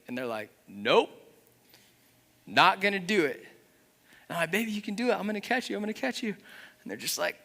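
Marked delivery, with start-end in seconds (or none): 0.7-3.4 put-on high, stubborn little kid refusing; a light toddler voice saying no. 4.5-6.3 put-on higher, gentle coaxing to a toddler; coaxing dad. Father coaxing child to jump.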